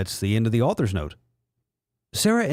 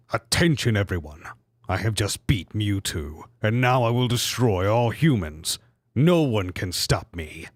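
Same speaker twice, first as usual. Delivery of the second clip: lower, menacing mock villain; 3 semitones lower than usual. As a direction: announce doom like a cartoon baddie. Villain Mew three voiced.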